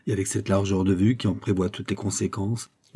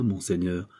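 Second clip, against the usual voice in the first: usual speaking voice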